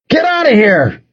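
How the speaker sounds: high, forceful yell; scream. A narrator's reading. Narrator trying to chase off a coyote, screaming. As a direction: scream to drive it off. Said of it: "I screamed at the coyote".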